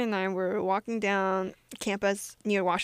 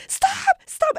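high, loud imitated shout; reenacted yelling; 6 dB louder than usual. Retelling a chase down the street, high loud yells. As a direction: call out as if chasing something. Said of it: "we'd be yelling at cars and yelling at the dog".

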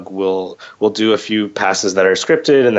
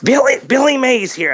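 Billy Mays. Retold or imitated. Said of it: "Billy Mays voice".

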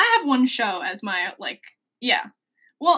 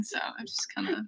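whisper; 14 dB quieter than usual. Real, not acted. Aside dropping to whisper.

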